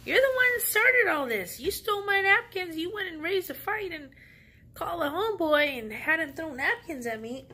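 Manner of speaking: high, loud, indignant accusing; angry accusation. Retold or imitated. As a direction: lay all the blame on her, shouting. Accusing the lady to her face.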